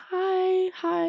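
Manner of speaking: squeaky, breathy-soft fake-casual hi; a tiny, strained high greeting to hide the tension; awkward high hello.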